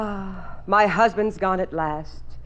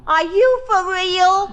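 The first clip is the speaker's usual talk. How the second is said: louder, drawn-out disbelief; hammed-up gasp of disbelief; mock shock at her lover; mock incredulous; 8 semitones higher, 5 dB louder, slower than usual.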